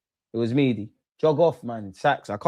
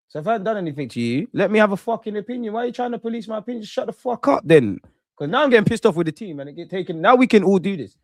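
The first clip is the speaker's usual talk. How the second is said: higher, fast angry outburst at the chat; heated rant; 8 semitones higher than usual. Real, not acted. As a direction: fire back, properly wound up. Snapping back at chat critics.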